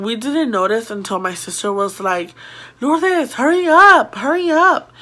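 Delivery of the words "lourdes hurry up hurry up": high, louder sing-out call; urgent calling out. Retold or imitated. Older girl calling her sister to hurry, urgent calling out. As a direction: imitate a sister's urgent yell.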